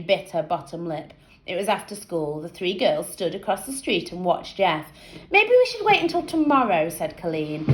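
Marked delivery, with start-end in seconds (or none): 5.3-6.9 put-on girl voice. A narrator's reading. Nervous girl hesitating.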